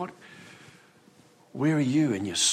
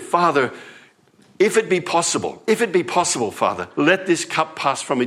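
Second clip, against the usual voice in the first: pleading Jesus; 6 semitones higher, 4 dB louder than usual. Acted. Jesus praying in Gethsemane.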